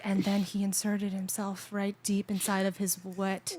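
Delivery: low, slow, monotone text-to-speech reading; Siri. Retold or imitated. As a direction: read it out evenly like a robotic phone assistant. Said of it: "So Siri is just in my AirPod".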